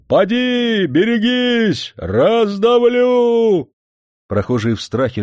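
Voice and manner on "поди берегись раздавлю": high, slow drawn-out bellow; coachman's bellow. A narrator's reading. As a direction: roar it across the street. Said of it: "орал".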